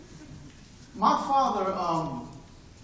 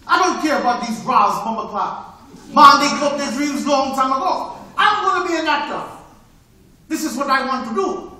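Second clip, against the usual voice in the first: Sid, a bit louder; 2 semitones higher, 9 dB louder than usual. Retold or imitated. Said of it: "Listen, Sid".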